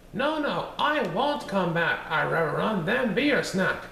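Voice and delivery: high, sing-song taunting cookie voice; bouncy little gingerbread man bragging; cheeky gingerbread man. Acted. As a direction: tease them from far out of reach. Gingerbread man refuses again.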